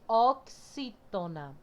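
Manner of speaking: "oxítona" slowly, syllable by syllable; sounded out slowly. Shown as a demonstration. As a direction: break "oxítona" into deliberate separate beats. Teacher sounds out the term.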